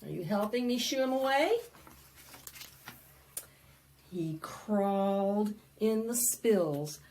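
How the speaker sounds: lively read-aloud. Acted. Librarian reading the fly book to children, loud and bouncy. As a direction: perform the book for a crowd of kids.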